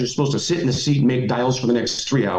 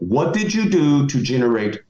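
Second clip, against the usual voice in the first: ordinary delivery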